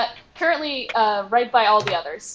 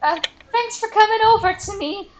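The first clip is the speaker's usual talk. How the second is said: higher, timid goblin voice; a small goblin confiding, pitched up; 9 semitones higher, 4 dB louder than usual. Acted. Goblin confides to adventurer about rude boss, pitched-up timid voice. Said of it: "the goblin looks up at you and goes".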